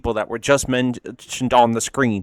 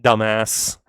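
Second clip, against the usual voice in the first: normal talking voice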